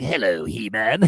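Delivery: slow villainous greeting, then cackle; gloating cartoon villain with a Joker-like laugh; Mark Hamill's Skeletor. Host mimics Skeletor entering the cartoon, hammy villain impression. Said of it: "That's a pretty damn good impression".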